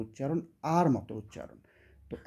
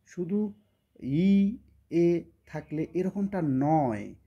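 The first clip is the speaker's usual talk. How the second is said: slow emphatic rule; 2 semitones higher, much slower than usual. Real, not acted. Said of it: "teacher insisting on a rule".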